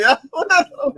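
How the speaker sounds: high, fast and laughing. Shown as a demonstration. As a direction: laugh the line out again.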